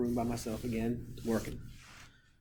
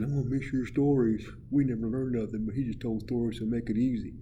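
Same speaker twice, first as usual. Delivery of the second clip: lightly voiced pupils, getting louder; a hint of student voice, raised; students quoted; 6 dB louder than usual. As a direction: lightly imitate kids giving feedback. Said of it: "a lot of you said".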